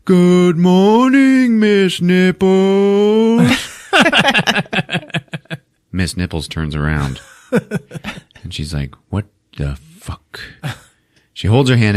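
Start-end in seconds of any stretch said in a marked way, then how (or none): 0.0-3.5 high, very slow class drone through false teeth; muffled chorus. Retold or imitated. Pupils wearing false teeth greet teacher, muffled drawn-out chorus. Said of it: "muffled voices". 9.1-10.4 slow, softly spoken disbelief; mock stunned. Teacher turning round to the gag, quietly stunned.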